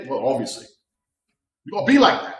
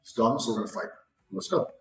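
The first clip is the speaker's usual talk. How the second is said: calm quiet menace; 4 semitones lower, 6 dB quieter, faster than usual. Retold or imitated. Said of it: "that sudden change in body language".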